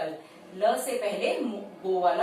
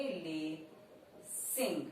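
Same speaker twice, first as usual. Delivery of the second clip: slow dictation; 4 semitones lower, 12 dB quieter, much slower than usual. Shown as a demonstration. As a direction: stretch the word lacing out very slowly and softly. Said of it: "word है lacing". (बहुत धीरे और धीमी आवाज़ में lacing बोलिए)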